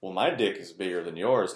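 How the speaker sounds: loud, cocky one-upping brag; mock boast. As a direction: show off like a bragging rival. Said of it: "I'm making fun of that".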